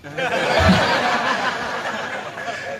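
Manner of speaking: a burst of laughter; laughing. Real, not acted. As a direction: laugh along with the crowd. Audience laughter after joke. (tertawa lepas)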